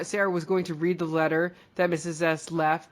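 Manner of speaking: ordinary talk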